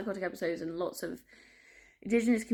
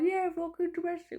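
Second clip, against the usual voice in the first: high, light sarcastic chirp; mock breezy quote; 11 semitones higher than usual. Imitating her flippant self to viewers, high and chirpy. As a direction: toss it off far too cheerfully. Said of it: "I'm like".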